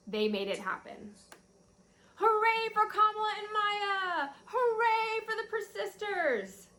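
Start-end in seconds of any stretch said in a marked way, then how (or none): 2.2-6.7 high, bright cheer for the heroes; joyful cheer. A narrator's reading. Teacher reading the happy ending to her class, cheering. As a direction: celebrate them like a crowd would.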